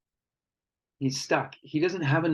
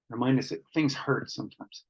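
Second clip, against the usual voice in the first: normal talking voice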